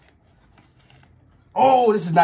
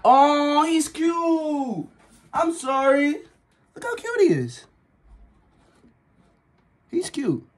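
higher tender cute voice; cooing; 8 semitones higher, much slower than usual. Real, not acted. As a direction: coo over a cute pet. Fawning over the rat.

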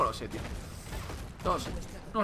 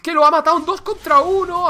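higher and louder, rattled outrage; excited disbelief; 9 semitones higher, 9 dB louder than usual. Real, not acted. Streamer reacting to a 2v1 kill, shocked loud outcry. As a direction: keep shouting in shock.

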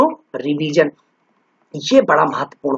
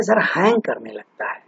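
softer voice, imitating a student; meek student admission; 2 semitones lower, 7 dB quieter than usual. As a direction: drop the volume for the student's reply. Student admitting the mind freezes.

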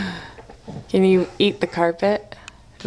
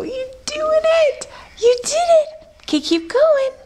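excited cheering. Real, not acted. Baby climbs, mother cheers.

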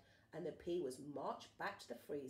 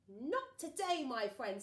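loud confident retort; cool hero; 5 semitones higher, 8 dB louder, slower than usual. Acted. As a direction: deliver it like an action hero. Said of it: "said Sue Potato".

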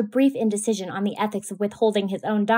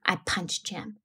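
ordinary delivery, nothing marked

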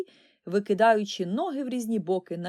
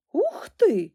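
high, loud wonder; delighted exclamation; 8 semitones higher, 7 dB louder than usual. A narrator's reading. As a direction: wow at the pearly knife. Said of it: "захоплено вигукнув".

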